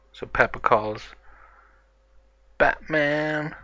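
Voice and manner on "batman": high, drawn-out summons; stretched, pitched-up name call. Acted. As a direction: call the hero from afar. Peppa calls for Batman.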